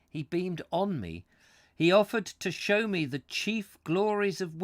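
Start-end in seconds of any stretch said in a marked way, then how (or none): 0.1-1.2 "on" pushed up high, then falling; stress on ON. Shown as a demonstration. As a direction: hit "on" to contrast it. Not at me.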